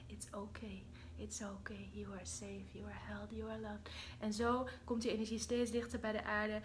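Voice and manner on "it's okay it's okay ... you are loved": whispered reassurance; tender whisper. Acted. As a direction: comfort them gently. Soothing mankind again.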